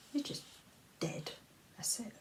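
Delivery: fading unvoiced whisper; bleak whisper. Ending her warning about suicide in a whisper. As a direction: let the word dead die on the breath.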